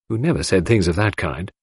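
ordinary delivery, nothing marked

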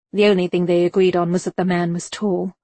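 ordinary talk

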